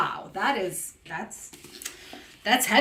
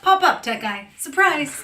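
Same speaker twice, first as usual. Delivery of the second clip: loud, bright welcoming call; cheery shout-out; playful call-out; 6 semitones higher, 7 dB louder than usual. Acted. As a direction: hail him in like a surprise guest. Greeting the tech guy joining live.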